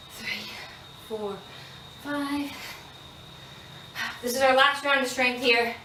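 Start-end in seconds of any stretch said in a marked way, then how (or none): 0.1-2.5 breathless whispered counting. Real, not acted. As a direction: barely get the numbers out. Counting reps while exhausted.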